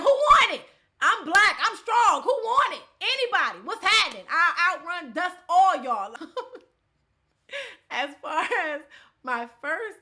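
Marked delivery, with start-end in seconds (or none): 0.0-6.1 high, loud, hyped-up challenge; boastful shouting. Retold or imitated. Retelling her walk out of the gym, hammed-up triumphant shout. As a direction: holler it like taking on all comers.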